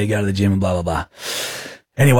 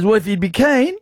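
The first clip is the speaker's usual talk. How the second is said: higher, imitated mate voice of a gym bro; chirpy blokey impression of a mate; higher put-on Aussie bloke voice; Smith Tech impression; 8 semitones higher than usual. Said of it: "Smith Tech impression".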